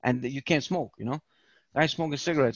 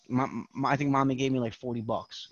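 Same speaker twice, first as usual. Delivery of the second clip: put-on small, softer, stammering like a scared kid; timid stammer; 5 semitones lower, 6 dB quieter than usual. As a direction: shrink into a nervous little voice.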